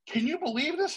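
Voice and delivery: excited disbelief. Retold or imitated. Excitedly asking Dave, in tears.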